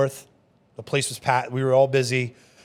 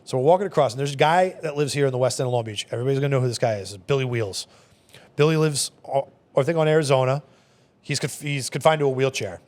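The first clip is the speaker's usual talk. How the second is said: ordinary talk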